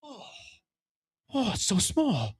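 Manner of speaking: slow, soft, awed stoner voice; tripping kid. Retold or imitated. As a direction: marvel at the carpet, spaced out. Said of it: "staring at the carpet like".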